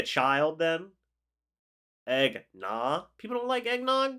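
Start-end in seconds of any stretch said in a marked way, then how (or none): 3.2-4.1 high, disbelieving question; can't believe it, pitch way up; surprised. Real, not acted. Disbelief that people dislike eggnog.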